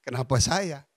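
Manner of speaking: kenapa saya falling, the question settling into resignation; resigned question. Retold or imitated. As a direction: let kenapa saya drop as if giving up. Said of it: "saya bilang". (bertanya pasrah dengan nada turun)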